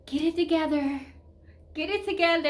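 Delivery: self pep talk. Retold or imitated. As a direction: urge yourself to pull it together. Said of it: "I constantly told myself that".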